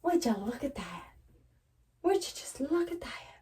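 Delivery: half-hearted impression of an amazed onlooker; imitating that one person. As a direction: play it like someone marveling at a mess. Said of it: "Sound like that one person when they go".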